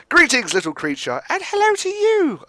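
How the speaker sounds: sudden squeaky rise on the last words; greeting the creature. Acted. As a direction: greet the little creature, voice jumping high at the end. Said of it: "I'm not doing voices".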